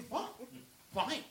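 usual speaking voice

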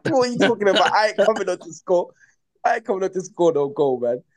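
high, fast, laughing brush-off; laughing dismissal. Retold or imitated. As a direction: laugh off the coach's big promise. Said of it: "I'm thinking".

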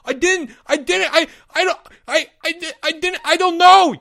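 very high, stammering wail; sobbing denial. Acted. Porge falling apart under questioning, sobbed stammer. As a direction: stutter it out, breaking down. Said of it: "sobbed Porge".

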